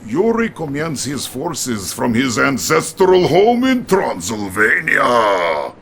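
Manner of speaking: usual speaking voice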